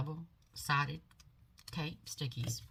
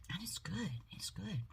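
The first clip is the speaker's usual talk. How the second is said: soft unvoiced whisper; whispered and much softer; 8 dB quieter than usual. Real, not acted. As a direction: whisper it like a secret treat. Savouring her peach tea.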